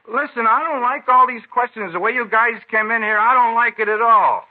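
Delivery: rising indignant protest; angry outburst. Acted. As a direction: push back hard at the cops. Suspect bristles at the questioning.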